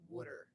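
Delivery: WATER said as "wooder"; first vowel of WATER as in wood; Delaware wooder. Shown as a demonstration. Spelling it out for friends, WATER pronounced "wooder". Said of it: "W O O D E R".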